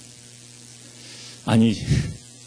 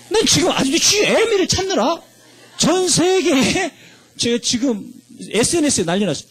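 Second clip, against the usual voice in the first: comic exclamation; 11 semitones higher than usual. Real, not acted. Comic search for GM.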